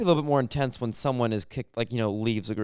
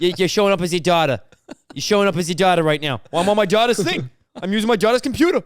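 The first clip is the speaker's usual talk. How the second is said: exasperated mafioso; 6 semitones higher, 6 dB louder than usual. Acted. Boss and member on zoom.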